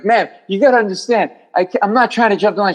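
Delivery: high, fast pleading to the receptionist; pleading patient. Retold or imitated. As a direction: beg her not to think you're cutting in. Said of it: "and I'm like".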